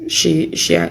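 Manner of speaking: ordinary delivery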